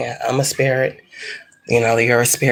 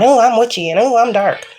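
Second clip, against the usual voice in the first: mock them as posers, pitched up; mocking wannabe witch; 8 semitones higher than usual. Mocking would-be witches who brag about being dark, smug. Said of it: "and you're this, and you're that".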